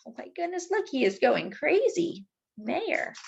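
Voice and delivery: usual speaking voice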